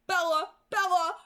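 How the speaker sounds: high, loud, crying pleas; sobbing plea. A narrator's reading. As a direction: break down pleading in tears. Angel sobbing over Bella.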